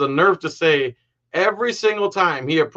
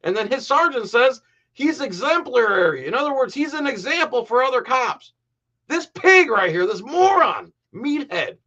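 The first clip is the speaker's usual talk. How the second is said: high-pitched, scornful outburst; outraged rant; 10 semitones higher than usual. Real, not acted. Commentator scoffs at the sergeant praising this cop, outraged. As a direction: pitch it way up in disbelief and contempt.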